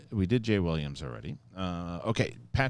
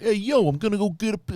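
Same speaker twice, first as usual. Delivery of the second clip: exaggerated movie New Yorker, pitched up; higher, louder mock New York "hey, yo"; mock New York accent; 12 semitones higher, 4 dB louder than usual. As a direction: ham up a New York tough guy. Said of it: "like, when Ellis does an impression of me".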